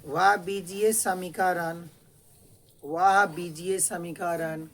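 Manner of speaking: slow, deliberate classroom dictation; measured, projected dictation. Real, not acted. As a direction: stretch each word for students writing it down. Teacher dictates for students to write.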